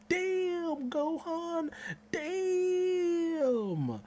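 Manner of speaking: high, loud cry of sheer hype; ecstatic fan yell. Retold or imitated. Fan reacting to Gohan's entrance, ecstatic high yell. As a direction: shout it like your hero just showed up. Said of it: "I'm just like".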